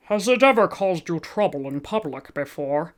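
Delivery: pinched nasal cop, matter-of-fact; police officer questioning in a nasal voice. Acted. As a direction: read it like a bored officer through the nose. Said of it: "I'm only doing nasal voices".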